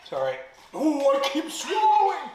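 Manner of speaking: high strained laugh from the heat; gasping and laughing through the spice; mouth burning from spicy food. Real, not acted. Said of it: "It actually feels like there's a fire in my mouth".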